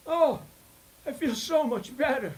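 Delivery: very high, loud sigh of relief; the patient's sudden, very high and loud relief. Retold or imitated. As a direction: burst out with relief as the pain lifts. Patient relieved after fart.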